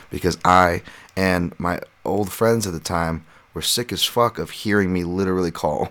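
ordinary talk, nothing marked

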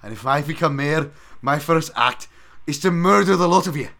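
a bad Scottish brogue, rising to a belted threat; Groundskeeper Willie. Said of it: "Oh, Willie".

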